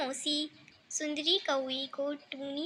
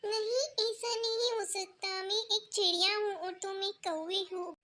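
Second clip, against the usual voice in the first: put-on higher-pitched young girl bird; young bird refusing; 6 semitones higher than usual. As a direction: turn down the suitor in a raised little bird voice.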